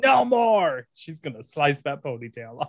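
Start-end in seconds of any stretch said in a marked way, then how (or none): 0.0-0.9 mock scream. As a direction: act out her scream at half volume. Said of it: "and she screamed".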